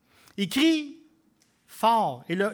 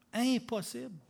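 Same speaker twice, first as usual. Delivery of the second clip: 1 quiet echo; 3 semitones higher, 6 dB quieter than usual. Real, not acted. Preacher repeating to the congregation, a soft quiet echo. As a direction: repeat impossible softly, much quieter.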